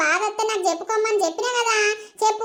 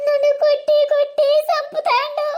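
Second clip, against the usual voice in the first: higher-pitched, anguished wail; crying; 4 semitones higher than usual. Acted. Beaten wife alone, calling for mother, wailing. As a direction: sob out the pain, drawing out the cries. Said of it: "ఏడుతున్నావు?".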